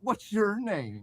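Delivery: Laughing Man. Acted. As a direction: draw the question out as a taunting ringmaster. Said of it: "he asked LaFranc".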